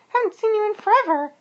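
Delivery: very high, squeaky worm voice; Walter greeting. A narrator's reading. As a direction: squeak it loudly to a visiting cousin. Walter greets his cousin.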